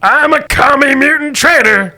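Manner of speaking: high, loud dim-witted mutant. Acted. Mutant announces its treason to the players, high and loud. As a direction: boom out the confession like a brute.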